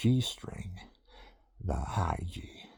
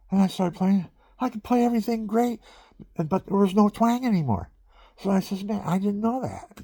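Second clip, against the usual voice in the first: higher and louder, thrilled at the discovery; excited; 11 semitones higher, 5 dB louder than usual. Real, not acted. Telling viewers how the broken string fixed his sound, excitedly. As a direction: lift it up with delight.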